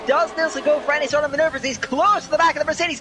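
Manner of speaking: urgent motorsport commentary; excited live play-by-play. Real, not acted. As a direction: call a daring overtake as it unfolds.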